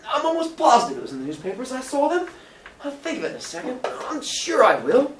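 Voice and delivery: high-pitched Billy voice; searching, insistent Billy. Acted. Billy tries to recall newspaper names, insistent.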